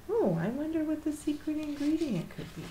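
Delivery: ordinary delivery, nothing marked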